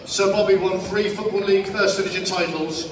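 normal talking voice

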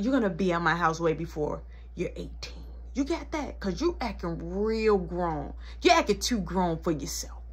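low, soft measured warning; stern mother. Acted. Mama warning child acting grown, low stern voice. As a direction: lecture with the voice dropped.

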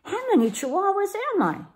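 high, quick wondering question; wondering out loud. A narrator's reading. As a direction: marvel aloud in a high kitten voice. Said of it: "he wondered out loud".

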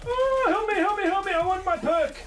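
high, hammed-up cry for help; mock panic. Player begging teammates for help, high mock panic. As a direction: squeal for rescue, very high.

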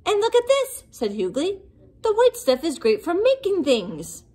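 can't wait to show them; excited monster. A narrator's reading. Hughley shows his snow creation, excited.

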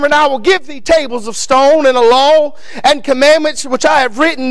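ordinary delivery

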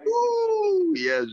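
high ecstatic ooh; joyful ooh. Real, not acted. Pastor crying ooh in praise.

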